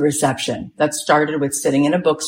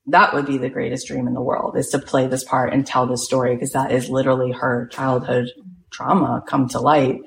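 ordinary talk

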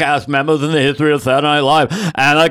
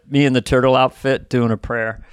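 ordinary talk, nothing marked

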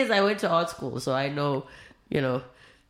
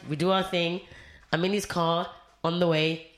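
ordinary talk

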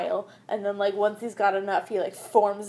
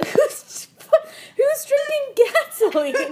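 laughing; 8 dB louder, slower than usual. Real, not acted. Absurd gasoline story.

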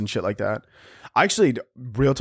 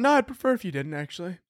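mock polite refusal; 6 semitones higher, 4 dB quieter, faster than usual. Acts out nervous watch owner refusing.

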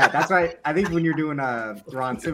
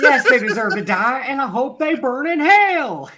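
slow, much higher and louder Southern cry; slow, much higher, louder mock courtroom plea; hammed-up movie line; 11 semitones higher, 6 dB louder, slower than usual. Quoting the same movie line.

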